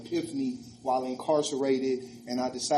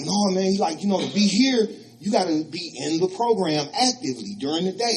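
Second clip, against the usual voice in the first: Rick insisting louder; 4 semitones higher, 4 dB louder than usual. Retold or imitated.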